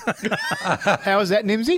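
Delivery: laughing through the question; can't stop chuckling while asking. Real, not acted. Host laughs at a cheeky listener text.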